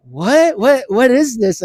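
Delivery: high, loud, baffled repeated "what"; astonished reaction. Retold or imitated. Fan retelling a first listen to the song, astonished. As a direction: re-enact being floored, pitch jumping way up.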